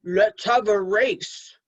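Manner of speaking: slow, drawn-out wolf voice; a slow sneaky wolf inviting a race; sly wolf. Acted. Wolf voiced in read-aloud story.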